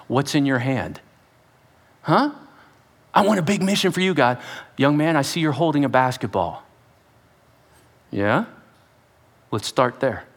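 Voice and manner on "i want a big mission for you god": mock eager rush. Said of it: "are saying".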